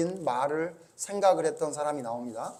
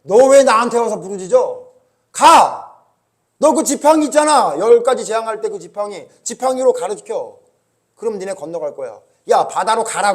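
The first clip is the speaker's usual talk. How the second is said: stern rebuke; 3 semitones higher, 10 dB louder than usual. Acted. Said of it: "하나님 뭐라 그래요?".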